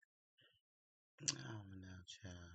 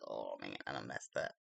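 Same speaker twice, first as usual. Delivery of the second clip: low, rueful voice of the guy; mock man's regret. Imitating the date's regret, low rueful voice. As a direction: sigh it out as the guy who blew it.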